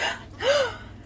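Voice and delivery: high mock gasp; imitated gasp. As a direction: gasp like a startled meme girl. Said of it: "the guy puts the arm around the girl and just".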